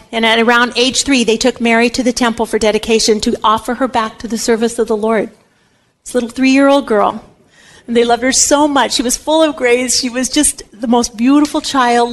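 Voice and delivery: ordinary talk, nothing marked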